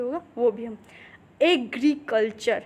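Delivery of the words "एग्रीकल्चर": एग्रीकल्चर said slowly, syllables clear; slow demo. Shown as a demonstration. Teacher modelling एग्रीकल्चर for the class, slow. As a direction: spell out एग्रीकल्चर by sound. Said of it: "ए, ए और ई".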